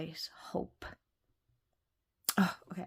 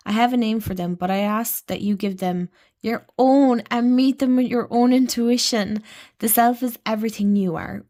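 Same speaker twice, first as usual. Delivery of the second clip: louder, fast, heartfelt read from the guidebook; emotional reading aloud; 10 dB louder than usual. Real, not acted. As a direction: read it out as it moves you. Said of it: "Why am I getting emotional about that?".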